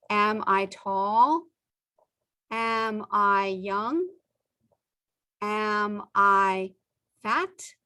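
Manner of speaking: slow, clear questions, each one rising at the end; slow teaching questions. Shown as a demonstration. Teacher modelling yes-no questions for English learners, slow and clear.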